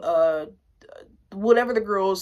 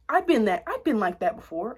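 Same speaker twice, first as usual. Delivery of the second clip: ordinary talk, nothing marked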